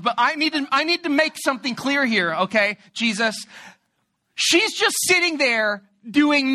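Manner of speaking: exasperated complaint. Retold or imitated. Martha complaining to Jesus, hammed-up sarcastic politeness.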